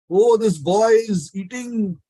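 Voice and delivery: high, drawn-out cry of dismay; retold shouting. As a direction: cry out slowly as if catching a culprit. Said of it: "you know, shouting".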